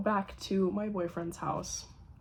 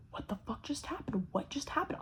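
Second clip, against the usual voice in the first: panicked mutter; 4 semitones higher, 9 dB quieter than usual. Retold or imitated. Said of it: "I was like".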